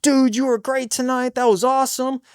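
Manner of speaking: high-pitched gushing praise; admiring fan. Retold or imitated. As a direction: imitate an excited fan after the show. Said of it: "When people are like".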